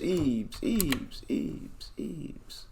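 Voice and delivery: repeated, dying away to a whisper; fading to whisper. Acted. Streamer mocks the odd name, fading to a whisper. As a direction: let it fade out to nothing.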